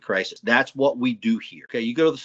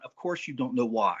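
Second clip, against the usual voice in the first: ordinary delivery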